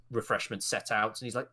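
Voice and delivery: ordinary delivery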